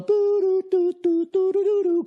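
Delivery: high, rapid boo-doo patter; imitated flurry noise. Comic mimicking a fighter's little moves, silly noise.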